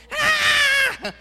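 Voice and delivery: high, loud mock newborn cry; hungry baby wail. Preacher imitating a hungry baby's loud wail. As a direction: wail like a starving infant. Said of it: "suena más bien como".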